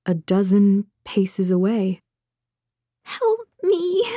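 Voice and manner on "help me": put-on high, small frightened child's whimper; a creature faking a scared child; much higher whimper, rising plea. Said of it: "it whimpered".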